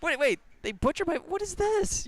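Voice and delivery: high-pitched mock outrage; devastated Dave Prowse. Actor finds his lines dubbed over, hammed-up devastated disbelief. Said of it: "imagine how devastated Dave Prowse was".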